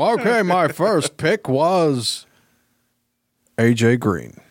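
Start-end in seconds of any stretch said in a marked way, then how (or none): 0.0-2.2 badly faked captain voice, slowing down; Picard. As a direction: put on a mock starship captain, drawing out the end. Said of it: "work on my Picard voice".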